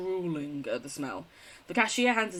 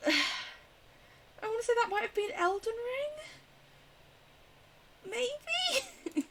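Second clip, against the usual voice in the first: unsure guess; 4 dB quieter, slower than usual. Real, not acted. Unsure guess, voice rising high.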